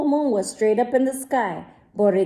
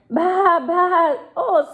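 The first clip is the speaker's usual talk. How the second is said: high, loud bleating "bah, bah"; lamb bleat; 8 semitones higher, 4 dB louder, slower than usual. Acted. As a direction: bleat like a frightened little lamb. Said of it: "Borreguita bleated".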